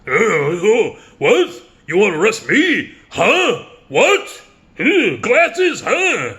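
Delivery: put-on high, loud bewildered mumbling old man; hammed-up confused Pacino, squeaky and loud; confused Pacino impression. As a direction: play a lost, baffled Al Pacino. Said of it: "Pacino looks like he doesn't even know where the hell he is".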